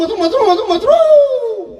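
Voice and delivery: mock shouting, building. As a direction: parody a ranting speaker hammering one word. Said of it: "अरे का ओरडतो का?".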